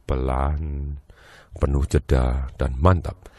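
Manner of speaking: low, slow voice full of pauses; slow and steady. Shown as a demonstration. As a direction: drop low and leave gaps between phrases. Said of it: "kualitas suaranya dibuat sama". (lambat, pelan, penuh jeda, mantap)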